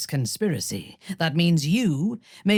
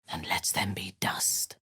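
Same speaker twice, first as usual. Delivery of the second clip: low, soft whispered curse; 8 semitones lower, 8 dB quieter than usual. Acted. Cursing her old master in a soft whisper. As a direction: let the hate fade to a breath.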